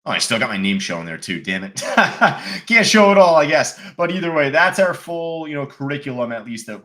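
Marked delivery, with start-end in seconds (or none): 1.8-2.6 quick amused laugh; short laugh. Real, not acted. Laughs at own name showing.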